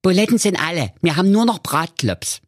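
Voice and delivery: high, squeaky mother voice; mother matter-of-factly turning the bear down; Mother Keule. Acted. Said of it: "und sagte".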